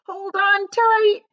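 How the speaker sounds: high, loud, slow stretched cry; excited shout. A narrator's reading. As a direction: hop with excitement as you yell. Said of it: "shouted the old green grasshopper".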